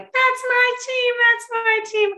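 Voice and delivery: high, loud team cheer, imitated; cheering wildly. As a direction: cheer your team on like at the Olympics. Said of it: "they're cheering, like, buck wild".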